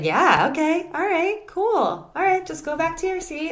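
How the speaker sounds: high, bright-pitched casual dismissal; breezy, high-pitched brush-off of a friend. Retold or imitated. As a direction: toss it off like it's no big deal.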